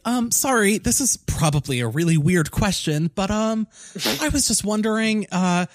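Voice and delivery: put-on high, hesitant awkward kid; nervous kid voice. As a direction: fumble through it like a shy boy. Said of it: "He's like".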